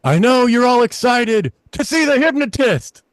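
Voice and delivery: high, slow announcement; manager on the mic. Retold or imitated. Owner teasing the crowd on the microphone, high and slow. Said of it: "and he goes".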